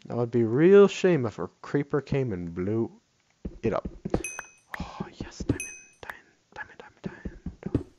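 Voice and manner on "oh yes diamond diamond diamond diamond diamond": excited whisper, voiceless. Real, not acted. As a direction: hush it out, thrilled. Excited over finding diamonds.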